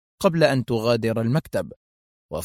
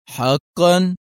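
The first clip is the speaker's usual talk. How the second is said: higher, drawn-out sleepy query; half-asleep; 6 semitones higher, 4 dB louder, much slower than usual. A narrator's reading. Hotelkeeper answering the police, drowsy drawl. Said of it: "كرر ماريو بلهجته نصف النائمة".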